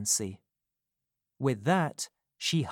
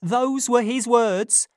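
put-on higher, louder, urgent young woman; Nancy risking her life telling Rose the truth, urgent and louder; 11 semitones higher, 8 dB louder than usual. A narrator's reading. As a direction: confess it as if your life depends on it. Nancy insisting.

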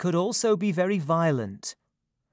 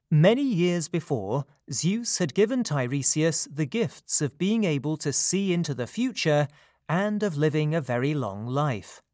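plain reading voice

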